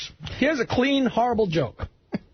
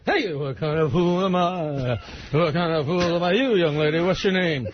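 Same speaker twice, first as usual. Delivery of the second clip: sing-mumble it like Vegas [name]; [name] impression; 3 semitones lower than usual. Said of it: "[name] is on stage".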